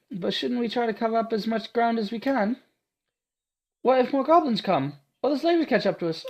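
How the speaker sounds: put-on high, eager girl's voice; awed young heroine praising her protector; timid young girl. Awed Ada gushing at Martha, high and breathless.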